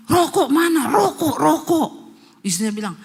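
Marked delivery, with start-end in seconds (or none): 0.1-1.9 loud, higher crazed demand for cigarettes; possessed yelling. Retold or imitated. Possessed husband yelling for cigarettes, loud. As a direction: yell it like a craving madman. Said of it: "teriak-teriak".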